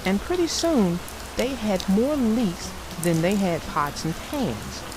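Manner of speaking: lively read-aloud storyteller voice; story narration. A narrator's reading. Narrator reading the Bear family story aloud to kids. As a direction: tell it like a bedtime picture book.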